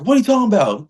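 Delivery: high-pitched mock incredulous challenge; retold indignant question. Man challenging the fan who told him to wait, imitated as indignant. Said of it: "he's like".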